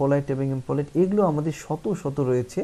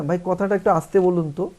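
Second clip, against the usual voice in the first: higher and louder rude order; rude loud demand; 6 semitones higher, 8 dB louder than usual. Shown as a demonstration. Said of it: "আমরা বলি না".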